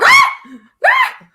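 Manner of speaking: high, loud shriek of panic; panicked scream. Retold or imitated. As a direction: scream in sudden alarm. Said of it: "And I was like".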